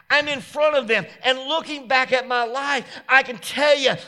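loud, high, shouted sermon climax; preaching at full force. Acted. Pastor testifies to the congregation, shouting with fervor. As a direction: bring it home, shouting praise.

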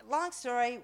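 ordinary talk

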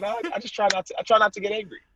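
high-pitched, over-sweet denial through a smile; million-dollar smile. Retold or imitated. Athlete brushing off his counselor, high and smiley. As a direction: put on a big smile and pitch it way up. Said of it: "my s-, million dollar smile".

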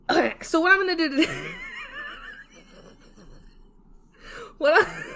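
high, squealing laughter breaking the words; helpless giggles. Real, not acted. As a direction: try to speak, collapse into giggles. Cannot restart for laughing.

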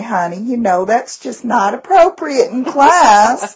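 sweet, slow Southern accent with a laugh in it. Shown as a demonstration. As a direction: chide a student like a syrupy Southern lady. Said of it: "go into my Southern".

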